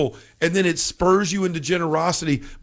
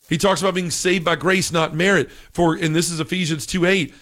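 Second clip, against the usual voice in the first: usual speaking voice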